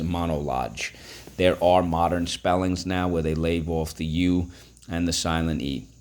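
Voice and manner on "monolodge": "monologue" ending in a j sound; "monologue" misread with a soft G at the end. Shown as a demonstration. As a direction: say "monologue" as mono-lodge. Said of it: "it could be read as".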